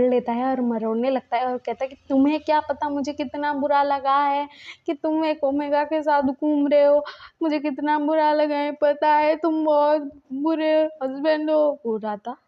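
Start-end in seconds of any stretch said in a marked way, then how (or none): 2.1-11.7 hurt scolding. Retold or imitated. Twisting ear, hurt scolding.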